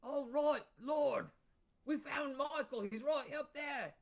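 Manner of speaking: lightly voiced accent of a wounded guard reporting to his lord; Mortar Slurry's son's accent. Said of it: "That's Mortar Slurry's son".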